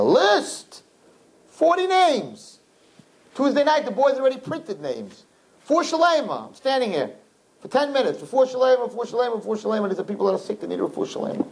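high, insistent preacher's voice; fervent preaching. Acted. Rabbi telling the girls about the prayer list, high and insistent. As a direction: hammer the names for the sick.

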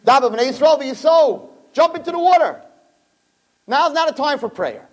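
high, urgent push to jump; God pressing Moshe to jump now, urgently high; urgent command. Retold or imitated. God urging Moshe to act.

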